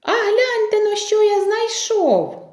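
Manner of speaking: high-pitched rooster's call. Acted. Rooster shows the mice his find, calling out high. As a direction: call them to come and see, high. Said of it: "став гукати".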